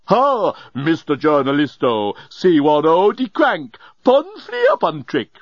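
put-on slow, singsong drunken chant; intoxicated nonsense rhyme. A narrator's reading. Intoxicated Leovinus quotes a nursery rhyme, tipsy singsong. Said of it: "was rapidly deteriorating".

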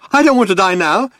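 high, loud panicky protest; yelled. A narrator's reading. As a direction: yell it in a panic. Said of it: "he yelled".

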